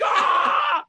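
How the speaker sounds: high shouted crowd ah; imitated crowd cheer. Guest imitating the studio audience, high shouted cheer. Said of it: "People are like".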